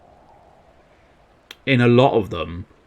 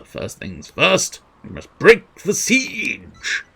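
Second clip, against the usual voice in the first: much higher, slow heroic declaration; slow character line; 7 semitones higher, much slower than usual. Acted. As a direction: declare it like a game hero. Voices game character line.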